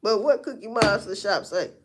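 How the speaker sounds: ordinary delivery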